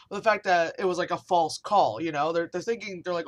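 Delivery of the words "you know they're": normal talking voice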